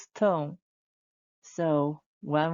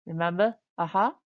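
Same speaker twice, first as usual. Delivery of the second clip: ordinary talk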